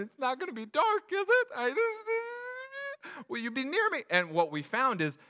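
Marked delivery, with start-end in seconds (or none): 0.0-4.0 put-on higher, small anxious kid voice with a nervous laugh; worried child. Kids asking dad before the next ride, higher anxious voice. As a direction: ask it like a scared little kid.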